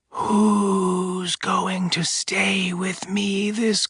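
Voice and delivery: very slow eerie whisper. Acted. The voice whispers right behind the woman. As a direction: breathe it right behind her ear. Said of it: "it was whispering".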